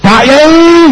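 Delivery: high, loud desperate call; shouting for help. Acted. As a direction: yell to get the cleric to turn. (teriakan minta tolong yang tinggi)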